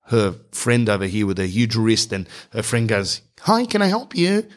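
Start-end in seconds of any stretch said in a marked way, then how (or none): 3.4-4.5 high-pitched chirpy customer-service greeting; perky mock greeting, pitched up; perky assistant. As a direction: imitate a preppy, perky helper. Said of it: "Much more preppier, much more per-, perkier".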